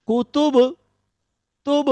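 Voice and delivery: কুতুব with the final ব bounced so তুব rings again as an echo; echoing bounce. Shown as a demonstration. Teacher showing the qalqala echo to students, bouncing the last sound. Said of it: "দ্বিতীয় ধ্বনিটি হচ্ছে".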